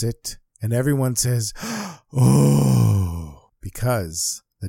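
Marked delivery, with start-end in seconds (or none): 1.6-2.1 sharp high intake of breath; crowd's stunned gasp; shocked gasp. A narrator's reading. Crowd shocked at missing diamond. 2.1-3.5 long, loud falling "oh"; drawn-out "ohhh" of dismay; shocked crowd. A narrator's reading. Crowd shocked at missing diamond.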